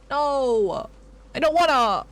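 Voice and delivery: a kid refusing dinner, hammed up; mock whiny protest. Streamer protests that salad is now on the menu, mock childish wail.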